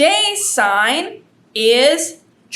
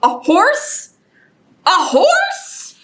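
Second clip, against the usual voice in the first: high rising questions, each more eager; eager cat, pitch climbing at the end; hopeful excitement; 7 semitones higher, slower than usual. Acted. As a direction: ask it higher each time, hoping hard. Cat hopes egg holds horse.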